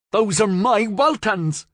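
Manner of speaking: high, loud, slow furious shout; drawn-out high roar of ownership; angry shout. Acted. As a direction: yell it, claiming what's yours. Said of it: "Florian shouted".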